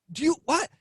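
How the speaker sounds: high, baffled stammer; totally thrown; mock bewilderment. Retold confused denial.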